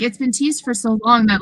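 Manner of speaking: normal talking voice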